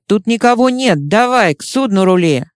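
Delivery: usual speaking voice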